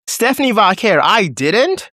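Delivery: high, hammed-up parody of a wrestling promo; mocking Nikki Bella. Fan mocking Nikki Bella's terrible promo, high parody voice. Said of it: "These were Nikki Bella's exact words".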